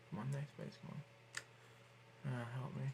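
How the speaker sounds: barely audible mutter, half whispered; muttered to self. Real, not acted. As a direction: grumble under your breath at the page. Kid muttering while stuck on page.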